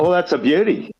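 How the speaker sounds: mock delighted approval; retold delight. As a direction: imitate a mate relishing a joke. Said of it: "it liked it".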